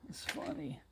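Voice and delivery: soft breathy whisper; whispered aside. Acted. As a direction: whisper it to yourself.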